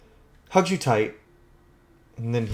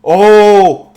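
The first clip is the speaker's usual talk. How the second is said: sudden realisation; 9 semitones higher, 18 dB louder than usual. Acted. Gluten realising the metaphor.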